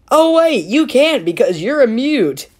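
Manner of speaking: high, loud fake realization; hammed-up oh wait; mock surprise. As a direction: jump up in pitch as if just remembering. Mock realization, big jump.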